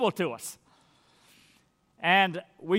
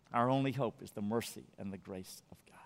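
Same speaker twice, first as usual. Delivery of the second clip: whisper; 8 semitones lower, 11 dB quieter, faster than usual. Real, not acted.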